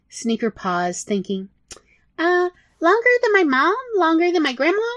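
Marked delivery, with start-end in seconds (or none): 2.2-4.9 much higher, bright squeaky rat; easy, breezy yes; high little rat voice; chirpy rat. Acted.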